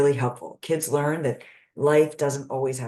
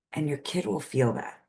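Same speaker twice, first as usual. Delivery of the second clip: ordinary delivery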